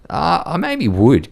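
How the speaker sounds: hesitant thought. Retold or imitated. Retold hesitant inner thought.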